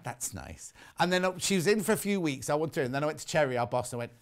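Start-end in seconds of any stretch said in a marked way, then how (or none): 0.0-0.7 deadpan brush-off. Retold or imitated. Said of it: "And I was like".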